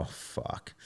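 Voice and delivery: soft unvoiced "Oh, fuck"; whispered curse. Seeing the first gymnast's big score, whispered dread. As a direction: let it slip out under your breath.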